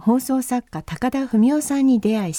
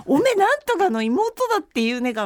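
lightly voiced Akita acquaintance, a bit higher; imitated Akita dialect; 7 semitones higher than usual. 上京時の知人を真似た軽い秋田弁. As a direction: mimic a hometown friend in Akita dialect. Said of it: "みたいな話で".

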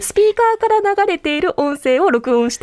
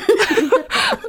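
sheepish laugh; 9 semitones higher than usual. Real, not acted. Laughing at own report.

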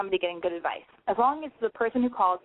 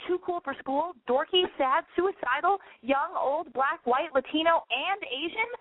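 excited listing; 7 semitones higher, slower than usual. Acted. Rattling off a list of listeners.

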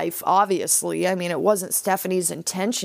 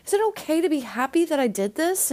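put-on little girl voice; 7 semitones higher, faster than usual. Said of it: "she's like".

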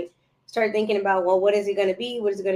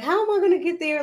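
higher-pitched mock fretting; retold worried question; 9 semitones higher than usual. Podcaster recalling doubts about her goal, fretting. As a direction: act out asking yourself how. Acting out her own past worry.

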